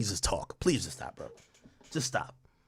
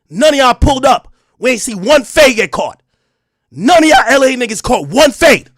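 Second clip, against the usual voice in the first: loud, high ranting call-out; furious shouted taunt; angry shouting; 8 semitones higher, 10 dB louder than usual. Real, not acted. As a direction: yell it out at the rivals. Ranting at LA podcasters.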